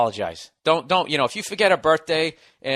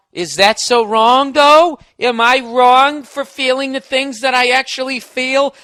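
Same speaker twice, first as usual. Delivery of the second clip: put-on whiny girlfriend; 9 semitones higher, 9 dB louder, slower than usual. Girlfriend whining in argument.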